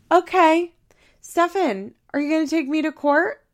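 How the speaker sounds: high, loud sarcastic jab at Stefan; mocking retort. [name] taunting Stefan about Madison's threat, high and sarcastic. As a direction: throw her threat back in her face, mockingly.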